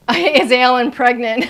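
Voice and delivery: wife teasing Alan on camera, laughing. Real, not acted. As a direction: tease him while cracking up. Laughing teasing her husband.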